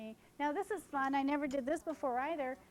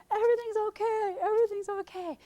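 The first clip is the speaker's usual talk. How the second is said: higher, louder reassuring call; groom calling out; 8 semitones higher, 8 dB louder than usual. Retold or imitated. Groom calling to the police cruiser, higher and louder. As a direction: wave off the police with a loud call. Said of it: "And he's going".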